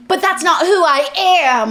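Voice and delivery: mock protest. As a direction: stretch it into an overplayed objection. Acting out heroine's protest.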